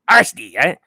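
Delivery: a hint of hype coach; mild hype impression; lightly voiced R C Tyler. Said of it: "R C Tyler here".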